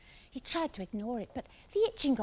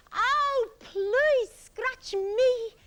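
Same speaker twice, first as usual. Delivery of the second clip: high, loud, drawn-out plea; beg, stretching the please; Iggy begging excitedly; 13 semitones higher, 7 dB louder, much slower than usual. A narrator's reading. Iggy shivering with excitement, high plea to Monkey. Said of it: "shivering with excitement".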